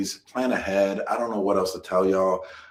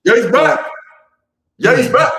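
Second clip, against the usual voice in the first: excited; 11 dB louder than usual. Real, not acted. Announcing return, high and loud.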